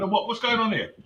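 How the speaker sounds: ordinary talk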